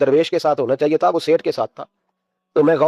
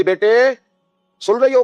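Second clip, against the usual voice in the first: calling out; 7 semitones higher, slower than usual. A narrator's reading. Father calls daughter again.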